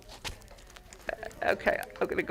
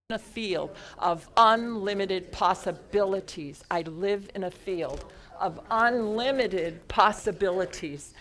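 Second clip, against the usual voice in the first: slow, deliberate affirmation for others to repeat; slow, measured mantra recitation; emphatic mantra; slower than usual. Shown as a demonstration. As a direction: stretch out each phrase with conviction. Leads the group repeating a mantra.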